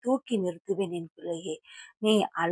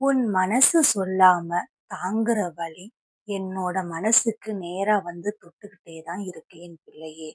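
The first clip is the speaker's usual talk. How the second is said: ordinary talk, nothing marked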